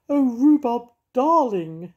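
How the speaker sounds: a poodle swooning over her hero; cooing. Acted. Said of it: "she cooed".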